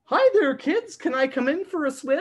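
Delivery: a bouncy dog begging to swim; friendly dog voice. Acted. Dog in the story greets the kids, high and cheery. Dog asks to join bath.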